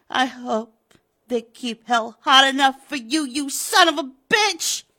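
loud, high spat curse; cursing. Acted. Widow cursing Pratt down the phone, furious. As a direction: hurl the curse at him.

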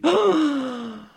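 high, loud delighted gasp; pixie gasp. Acted. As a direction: gasp like a touched fairy. Pixie gets pennies.

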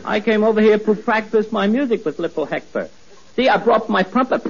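"trumpet" comes out "pumpet", T turned to P. Acted. Boy shows off his trumpet, T said as P. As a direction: puff a P wherever a T belongs. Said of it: "He can't say the letter T".

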